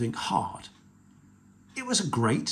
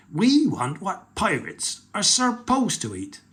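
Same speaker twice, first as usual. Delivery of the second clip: pirate chief voice; 7 semitones higher, 3 dB louder than usual. Acted. Chief insisting.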